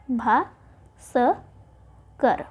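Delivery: भा, स, कर split apart with pauses; भा स कर said slowly, one syllable at a time. Shown as a demonstration. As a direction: break भास्कर into three spaced parts. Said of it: "भास्कर आपका गलत".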